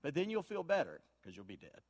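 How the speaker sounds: softly mumbled doctor's evasive diagnosis; a lightly voiced doctor glossing over bad news; doctor quote. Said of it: "I don't want him to go".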